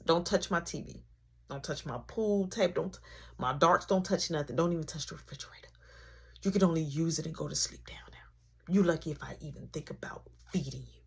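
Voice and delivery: low, soft threatening list; soft menacing mom; quiet threats. Retold or imitated. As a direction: hiss the rules under your breath.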